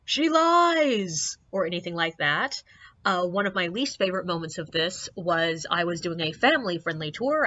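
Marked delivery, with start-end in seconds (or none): 0.0-1.4 high mock yell from a passing car; high jeering shout; retold scream. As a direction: shout it like a heckler out a car window. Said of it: "or even just scream out".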